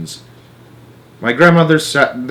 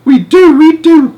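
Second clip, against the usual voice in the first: very high, loud cry; father crying out about the cat; cried; 11 dB louder, slower than usual. Acted. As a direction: cry it out in alarm. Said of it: "cried Mister Jenkins".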